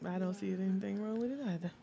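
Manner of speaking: hushed aside, barely voiced; whispering. Real, not acted. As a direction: whisper it under your breath. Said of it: "she tried to whisper it".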